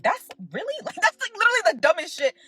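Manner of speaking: high, incredulous laugh through the words; laughing disbelief. Real, not acted. Laughing disbelief at silly claim.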